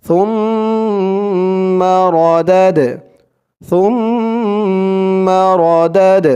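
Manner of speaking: সুম্মা র দাদ read slowly with the dal bounced as qalqala; careful slow recitation with qalqala on dal; slow tajweed reading. Shown as a demonstration. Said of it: "দাল ... ধাক্কা দিয়ে পড়তে হবে".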